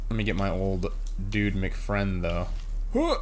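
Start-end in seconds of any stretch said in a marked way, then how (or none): 2.9-3.2 very high, short rising whoop; quick squeaky hop noise. Real, not acted. Jumping noise in game.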